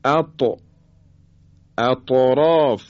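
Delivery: اط with the ط bounced off audibly; qalqalah on ta. Shown as a demonstration. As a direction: slowly pop the still ط in اطراف. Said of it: "حرف طا".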